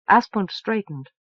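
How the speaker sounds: ordinary delivery, nothing marked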